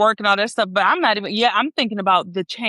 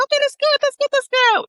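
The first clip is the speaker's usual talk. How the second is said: mock cheering; 4 dB louder than usual. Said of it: "everybody be like".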